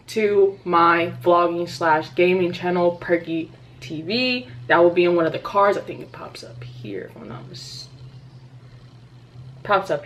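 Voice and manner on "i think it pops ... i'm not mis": whispered. Real, not acted. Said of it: "unsure aside".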